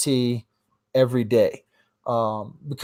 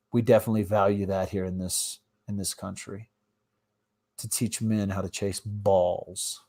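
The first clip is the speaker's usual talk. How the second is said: dry sarcasm; 6 semitones lower, 4 dB quieter than usual. Real, not acted. Mocking the school football budget.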